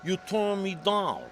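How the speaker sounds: high, slow Jersey caller voice; Jersey tough guy. Retold or imitated. Jersey guy phoning the illustrator who refused him, mock tough. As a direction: put on a Jersey mobster's drawn-out accusation. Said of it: "What is the mob calling me?".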